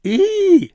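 very high, loud shriek; sudden squeal of fright; startled shriek. A narrator's reading. Elf startled by someone leaping from the sleigh, shrieking. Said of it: "Dabbledy shrieked".

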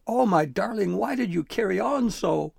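high, loud distraught lament; yelling. A narrator's reading. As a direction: cry out to your darling in reproach. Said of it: "Gristle yelled".

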